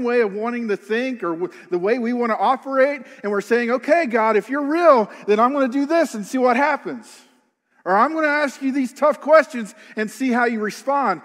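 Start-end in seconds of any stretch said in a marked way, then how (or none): 3.7-7.1 higher, louder, daring God to prove himself; defiant challenge to God. Retold or imitated. A believer testing God, in a defiant challenge. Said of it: "it kinda has a different tone". 7.9-11.2 higher, louder, daring God to prove himself; defiant challenge to God. Retold or imitated. A believer testing God, in a defiant challenge. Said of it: "it kinda has a different tone".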